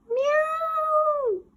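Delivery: very high screeching meow; screaming cats. Acted. Cats terrified by water, screeching.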